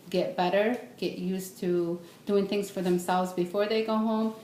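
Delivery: normal talking voice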